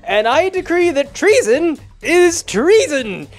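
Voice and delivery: loud, high-pitched, drawn-out proclamation; decreeing judge. Acted. Fate binder passing sentence on treason, proclaiming loudly.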